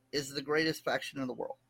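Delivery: grudging forced praise; not meant. Real, not acted. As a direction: get through the praise reluctantly, dropping in volume. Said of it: "No, say it like you mean it".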